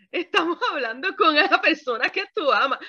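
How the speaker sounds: normal talking voice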